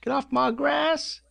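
high, slow mock yell of a cranky neighbour; yelling at kids on the lawn, hammed-up; grumpy old man. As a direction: shout like a grouchy old-timer off his lawn.

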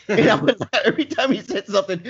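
real laughter breaking into the words; laughing. Real, not acted. He recalls laughing at Candyman while telling the podcast host, real laughter. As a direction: laugh through the line.

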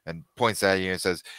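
normal talking voice

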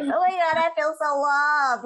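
a mock swoon, drawn out and bright with joy; hammed-up gushing delight. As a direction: gush like the best gift ever. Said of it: "And I was like".

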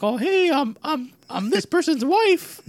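high, slow squeaky cartoon mouse; Minnie Mouse voice. Friend plays Minnie Mouse in guessing game, high squeaky slow voice. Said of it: "guess that they were Minnie Mouse".

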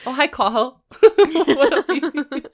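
loud high laughing greeting. Real, not acted.

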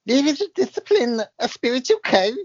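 high, loud spirit-medium voice; channelled spirit voice. Pretend. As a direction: let the spirit speak through you, high and grand. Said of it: "the amazing voice coming through me".

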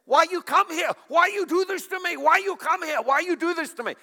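loud, high accented tirade, mock outrage; imitating yelling cleaner. Singapore cleaner bursts in yelling at visiting preacher, imitated high yelling. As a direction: mimic a woman yelling accusations, high and loud. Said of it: "she starts yelling at me".

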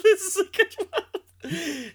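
high, laughing, slow. Real, not acted. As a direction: laugh through the words. Laughing while introducing comment.

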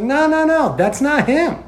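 high, pleading no no no; a drunk girl cutting in; retold drunk girl. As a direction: hurry to stop him. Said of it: "she's like".

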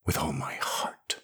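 very soft, near-whispered vow; hushed intensity. A narrator's reading. Jirel swears her hate to the voice, hushed. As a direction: breathe it out low and quiet.

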